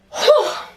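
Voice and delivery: long, high exhale of relief; tired sigh. Real, not acted. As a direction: let out a big sigh at the end. Wraps up a long video.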